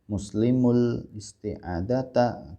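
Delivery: drawn out syllable by syllable with breaks; over-slow classroom demo of Arabic; very slow reading. Shown as a demonstration. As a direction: read it slowly, word by word. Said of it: "pelan-pelan".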